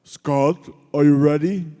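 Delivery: loud coach call with a hint of Polish; Kowalski's Polish gravelly voice. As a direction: call it out like the old trainer. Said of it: "in his Polish gravelly voice would say".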